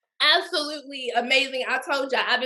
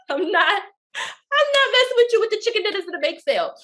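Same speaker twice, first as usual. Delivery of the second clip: laughing; 7 semitones higher than usual. Real, not acted. Laughing through joke.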